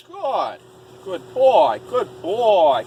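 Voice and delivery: squeaky praising a dog. Real, not acted.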